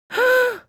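sharp high inhale; big gasp. A narrator's reading. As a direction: gulp in a huge breath. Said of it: "took a very large breath".

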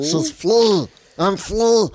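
high, loud mock mumble; mimicking garbled actress. Friend imitates the actress saying her name in a high loud voice. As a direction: blurt the name like a flustered actress.

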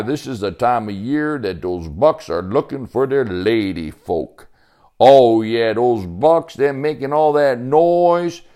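loud, folksy country character voice; hearty backwoods Sebastian, knowing. Acted. Sebastian explains the bucks' racket to Joey, hearty. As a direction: explain it with an old woodsman's relish.

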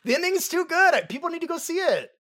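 high-pitched urging through laughter; real giggly, high enthusiasm; laughing insistence. Real, not acted. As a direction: plead excitedly, still laughing. Laughing, urging people to watch.

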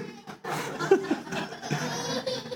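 very high mocking squeal; a shrill burst of laughter; hammed-up squeal; squeaky laugh. Strangled laugh in rant.

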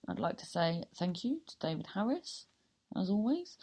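ordinary talk, nothing marked

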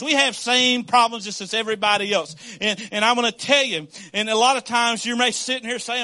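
ordinary delivery

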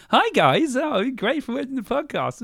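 high, bright fake host greeting; mock cheesy presenter intro; fake YouTuber voice. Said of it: "fake facades".

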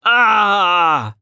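sharp cry of pain; pained shout. Acted. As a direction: yell out as bones grind. Cry of pain during healing.

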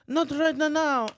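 high, slow lordly brush-off; mock dismissive Macron. Macron dismissing his servant, lordly mock brush-off. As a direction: wave it off like a pompous president. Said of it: "he goes".

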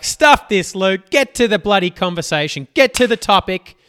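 fed-up listener. Pretend. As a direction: play a listener who has lost patience with his rambling. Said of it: "Are you like".

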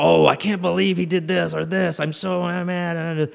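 high-pitched imitation of griping colleagues; hammed-up indignant complaining; mock outrage. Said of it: "they try to tell these stories".

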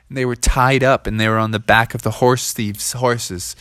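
plain reading voice

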